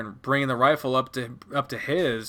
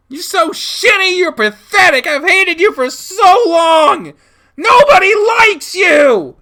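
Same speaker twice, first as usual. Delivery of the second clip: very high, loud screaming tirade; hysterical fury; 11 dB louder than usual. Acted. Child lashing out at dad, hysterical screaming. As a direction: scream insults at full pitch.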